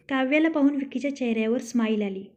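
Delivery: ordinary delivery, nothing marked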